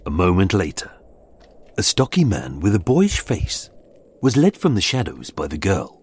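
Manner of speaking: usual speaking voice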